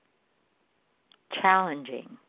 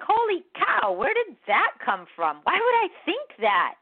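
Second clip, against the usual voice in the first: much higher, startled exclamation; shocked inner voice; 11 semitones higher than usual. Retold or imitated. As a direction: gasp at your own thought, pitched way up. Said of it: "And I'm like".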